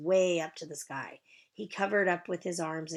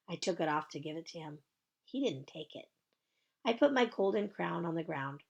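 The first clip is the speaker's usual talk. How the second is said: plain reading voice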